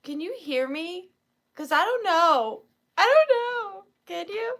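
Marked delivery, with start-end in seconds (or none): 3.0-4.6 high, loud mock-worried cry; mock panic. Streamer unsure viewers can hear her, playful panic. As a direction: raise it high and loud in pretend alarm.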